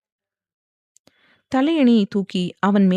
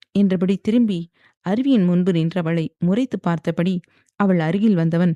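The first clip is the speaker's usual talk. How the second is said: ordinary delivery, nothing marked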